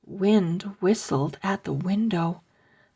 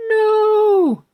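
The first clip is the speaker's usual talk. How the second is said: high loud shout of no; 11 dB louder than usual. Acted. Boys refusing the zombie, shouting high. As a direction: yell it to slam the window.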